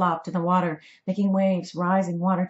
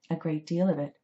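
usual speaking voice

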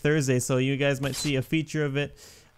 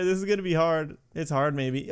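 ordinary delivery

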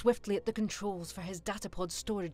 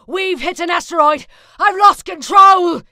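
panicked scream, much higher and louder; terrified pilot shrieking in alarm; 10 semitones higher, 21 dB louder than usual. Acted. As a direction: scream it as the ship spins. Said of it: "he screamed".

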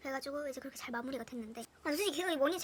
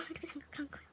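ordinary talk

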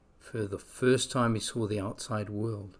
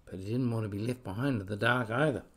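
ordinary delivery, nothing marked

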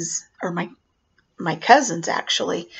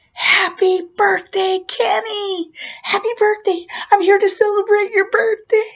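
excited clown greeting; 12 semitones higher, 5 dB louder than usual. Retold or imitated. Clown at the door to little Kenny, excited birthday greeting. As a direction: hail the birthday child like a party clown.

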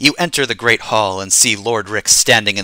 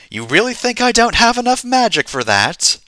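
indignant challenge; 13 semitones higher than usual. Acted. Player challenged on his magic.

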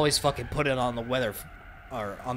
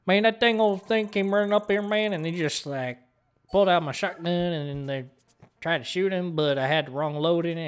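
put-on louder, higher Southern accent telling the buck story; excited southern accent; 7 semitones higher, 6 dB louder than usual. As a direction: get worked up in a Southern accent. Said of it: "at the southern accent".

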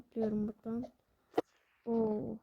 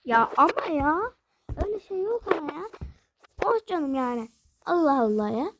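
louder, higher, near tears with frustration; frustrated whining; 4 semitones higher, 4 dB louder than usual. Real, not acted. Gamer after getting killed again, frustrated whine. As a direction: whine like the game cheated you. Dying in game, frustrated complaints.

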